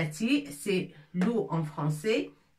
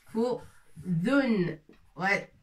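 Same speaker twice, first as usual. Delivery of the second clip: ordinary talk, nothing marked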